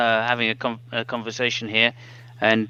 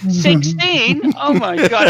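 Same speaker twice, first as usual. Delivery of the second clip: mock surprise; 10 semitones higher, 4 dB louder, slower than usual. Mock delight at insult.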